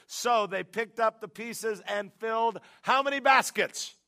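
full-force preaching. Acted. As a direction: drive each point home to the congregation.

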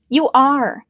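mild stubborn plea; stubborn insisting. A narrator's reading. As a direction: insist on it, almost begging. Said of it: "she insisted, stubbornly, almost pleading".

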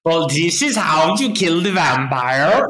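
put-on hacky German-accented English; fake German accent. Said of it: "in, like, a very hacky, like, fake German accented English".

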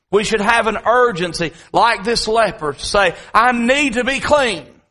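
builds high and loud to a pleading cry; preacher's rising cry. Acted. Preacher to congregation, rising to a loud plea. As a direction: let it swell into a desperate plea.

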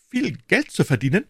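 high, eager question; greedy interest. A narrator's reading. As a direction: shopkeeper perks up at money. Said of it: "sagte Bonacieux".

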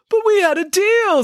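high, loud outraged protest; a wronged hero crying foul; high loud indignant yelp; indignant Hercules. Acted. Hercules protests being tricked.

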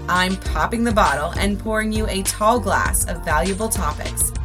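upbeat, polished host intro; podcast intro. Acted. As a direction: read it like an upbeat show opener. Scripted podcast intro over music.

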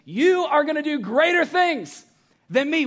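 incredulous quote. Retold or imitated. Pastor echoing astonishing verse.

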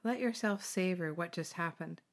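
usual speaking voice

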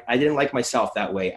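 normal talking voice

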